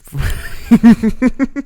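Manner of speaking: sudden high burst of laughter; loud laugh. Real, not acted. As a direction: break up laughing mid-sentence. Bursts out laughing mid-reading.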